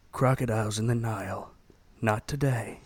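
hushed, lazy movie-star commercial; Matthew McConaughey ad. Pretend. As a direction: murmur it like a cool movie star's fragrance ad. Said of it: "Matthew McConaughey".

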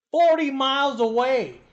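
high, loud, drawn-out boast; emphatic amazement. Retold or imitated. Quotes amazed visitors on distance traveled.

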